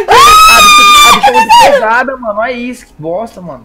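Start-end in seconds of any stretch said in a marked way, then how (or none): 0.0-2.1 very high, slow strained yell; straining shout. Real, not acted. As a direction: yell like pushing with all your might. Straining to push heavy character.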